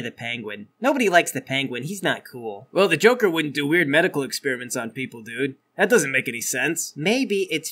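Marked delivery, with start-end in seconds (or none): none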